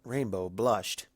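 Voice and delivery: plain reading voice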